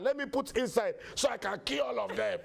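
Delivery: high, hammed-up threats; bossy son shouting. Son threatening robbers and ordering daddy, shouting high.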